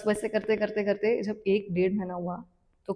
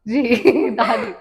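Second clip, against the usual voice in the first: laughing; 6 dB louder, much slower than usual. Real, not acted. Surprise that grandmother was guru.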